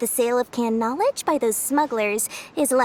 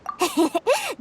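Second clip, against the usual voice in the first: knowing laugh; 7 semitones higher than usual. Acted. Laughs off the accusation.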